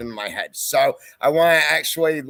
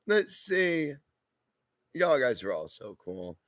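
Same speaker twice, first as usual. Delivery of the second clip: ordinary delivery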